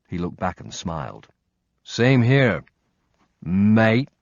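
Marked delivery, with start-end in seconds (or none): 1.8-4.1 piss-taking Australian twang on mate; mock Australian accent. Josh mocking Nick's Brit greeting in a put-on Australian accent. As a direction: tease him back in a put-on Australian accent. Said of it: "His piss-taking accent sounded more Australian than English".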